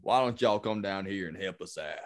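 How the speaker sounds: Texan local inviting folks in; Texas accent. Shown as a demonstration. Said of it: "give me a Texas real quick".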